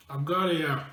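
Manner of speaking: chewing mid-sentence; messy loud eating. Real, not acted. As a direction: mouth full of chips. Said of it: "I'm gonna be talking with my mouth full".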